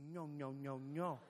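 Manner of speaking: low-voiced old Spaniard; low, repeated refusal from an old Spaniard. Old Spaniard correcting the tourist next to him, calmly dismissive. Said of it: "was this, was this old Spaniard".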